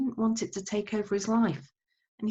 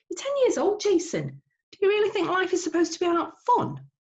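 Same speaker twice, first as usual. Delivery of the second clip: incredulous Dad; 14 semitones higher than usual. Acted. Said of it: "asked Dad".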